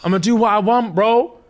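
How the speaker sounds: imitated indignant declaration; mock defiance. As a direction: act out standing your ground, pitch climbing. Acted defiant quarrel.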